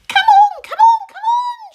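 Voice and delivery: very high, loud centipede yell; impatient shout. Acted. Centipede shouting impatiently at the glowworm. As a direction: hurry her with a yell. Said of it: "shouted the centipede".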